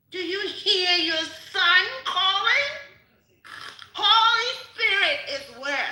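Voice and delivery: loud, high fervent cry; preaching shout. Real, not acted. Calling the gentlemen to hear the son, shouting. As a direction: preach it out at full force.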